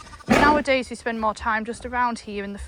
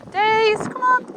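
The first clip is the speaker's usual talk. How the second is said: high, louder call across the field; raised high call to a wandering dog; 11 semitones higher, 8 dB louder than usual. Real, not acted. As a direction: call her back, pitched up and carrying. Calling the dog from afar.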